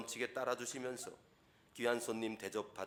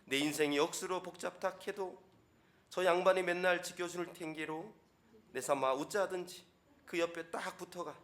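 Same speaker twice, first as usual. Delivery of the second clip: higher and louder, broad Gyeongsang accent with laughs; hammed-up Gyeongsang reading of Psalm 23, raised pitch; Gyeongsang dialect; 7 semitones higher, 5 dB louder than usual. Shown as a demonstration. Pastor reads Psalm 23 to congregation in Gyeongsang dialect, higher and louder. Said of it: "경상도 버전은요, 약간 한 톤을 좀 높여야 돼요".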